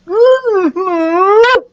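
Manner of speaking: high, loud muffled squeal; muffled sputter. A narrator's reading. Carlos smushed in mom's hug, sputtering. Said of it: "Carlos sputtered. His face was smushed".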